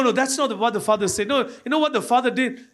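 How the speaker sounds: normal talking voice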